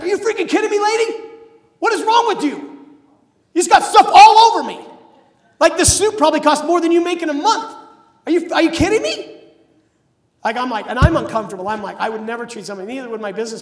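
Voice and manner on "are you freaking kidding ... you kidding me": much higher and louder, a shrill enraged rant; furious outburst. Retold or imitated. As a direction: tear into her like a pompous banker losing it. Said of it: "he literally loses his mind".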